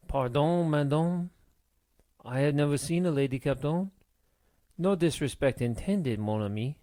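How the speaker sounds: put-on pleading French-accented sailor. A narrator's reading. Rescued sailor apologises to the lady captain, French accent. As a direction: beg pardon in a French accent. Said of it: "begged in a French accent".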